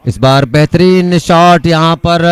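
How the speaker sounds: loud, drawn-out shot call; excited shot call. Real, not acted.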